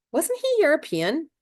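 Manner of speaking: high naive question. A narrator's reading. Mildred asks Montag innocently, high and light. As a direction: ask it brightly, without a clue.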